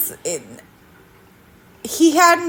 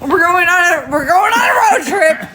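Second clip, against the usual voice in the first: high, excited call-out; excited shout; 7 semitones higher, 3 dB louder than usual. Real, not acted. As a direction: announce it like a party. Excited announcement.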